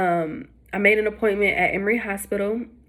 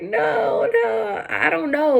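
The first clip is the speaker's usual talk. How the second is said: overwhelmed reply; 13 semitones higher, much slower than usual. Retold or imitated.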